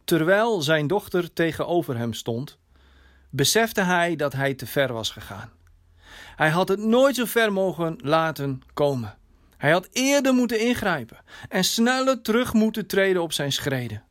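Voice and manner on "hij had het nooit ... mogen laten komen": ordinary delivery, nothing marked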